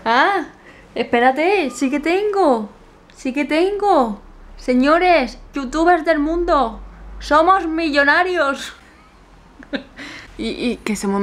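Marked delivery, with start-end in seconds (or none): 0.1-4.2 mock realization. 4.6-8.7 louder, slow pompous announcement; hammed-up triumphant decree; mock grand proclamation.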